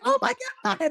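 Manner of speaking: very high, giddy exclamation; starstruck squeal. Retold or imitated. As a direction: squeal it, breaking into laughter. Retells own starstruck reaction.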